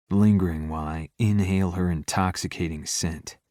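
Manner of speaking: ordinary delivery, nothing marked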